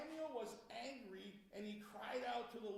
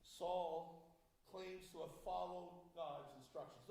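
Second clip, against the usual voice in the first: normal talking voice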